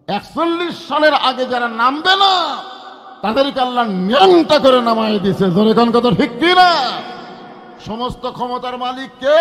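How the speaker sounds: loud, high sermon shout; fiery preaching. Real, not acted. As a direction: hammer the point home.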